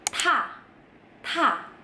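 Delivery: "ta" as breathy "tha", T plus H; strongly aspirated T. Shown as a demonstration. Teacher demonstrating the next letter, strong puff. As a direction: blast "ta" out with a big H. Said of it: "T H banget".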